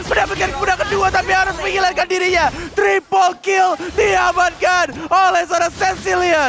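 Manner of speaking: rising hype play-by-play call; fighting game announcer. Real, not acted. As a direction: build to the kill call. Caster calls a triple kill.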